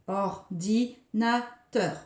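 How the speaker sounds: Ordinateur stretched slowly, beat by beat; slow syllables. Shown as a demonstration. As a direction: scan Ordinateur one syllable at a time. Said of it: "scander les syllabes". (Ordinateur scandé très lentement)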